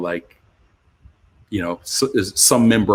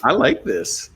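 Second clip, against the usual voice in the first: retold delighted realization; 11 semitones higher than usual.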